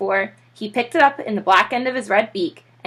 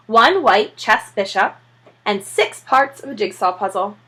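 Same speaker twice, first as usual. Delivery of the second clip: plain reading voice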